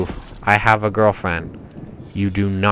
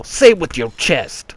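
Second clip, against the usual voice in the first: raised, punchy impression of a comedian's uncle; Kevin Hart's uncle; 7 semitones higher, 3 dB louder than usual. Quoting the uncle's advice on cursing, put-on emphatic voice. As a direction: imitate him urging it out forcefully. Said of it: "Kevin Hart's uncle".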